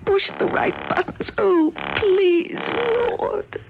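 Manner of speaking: strained sobbing. Acted. Struggling alone, tied up.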